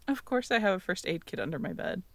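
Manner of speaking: ordinary delivery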